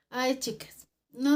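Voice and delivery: normal talking voice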